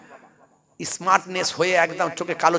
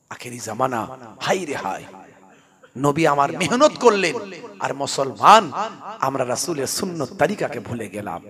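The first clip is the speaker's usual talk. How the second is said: mock preaching; imitated waz sermon; 3 semitones lower, 4 dB louder than usual. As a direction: preach like a village huzur.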